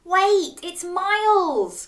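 high, drawn-out alarm; alarmed gasp. A narrator's reading. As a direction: gasp it out as a shocked dog. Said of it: "gasped Dog".

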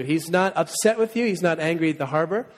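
usual speaking voice

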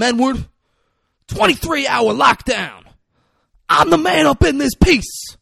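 high, loud imitated rant; full-blast movie villain tirade; Denzel Washington. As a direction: shout threats at the whole neighborhood. Acting out Denzel's ranting threats.

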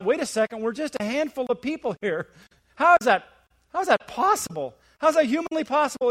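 worried disbelief. Acted. Disciples panic at the mission.

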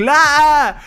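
high, drawn-out shout of شيطان; the boy shouting as he strikes the cat; kid's slow yell. Retold or imitated. (طفل بيزعق ببطء وصوت عالي)

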